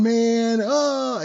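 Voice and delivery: high, loud patient's wail; hammed-up howl of distress; mock screaming. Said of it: "he's laying there screaming".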